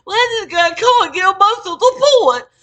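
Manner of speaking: high, loud mock whine; mocking coworker. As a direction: mimic her excuses mockingly. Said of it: "I'm like".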